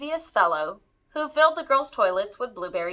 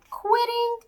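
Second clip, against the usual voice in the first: much higher incredulous cry; surprised cry; 13 semitones higher than usual. Acted. Murray asked by the elf if he still wants to quit, high incredulous cry. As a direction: bounce the word up in disbelief. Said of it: "cried Murray".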